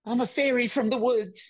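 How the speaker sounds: high, put-on fairy voice; hammed-up fairy impression. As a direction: play a dainty woodland fairy. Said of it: "he goes".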